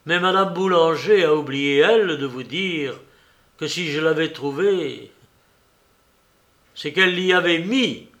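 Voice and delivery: ringing denunciation. A narrator's reading. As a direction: turn the tables on her. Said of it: "la voix éclatante de Rouletabille".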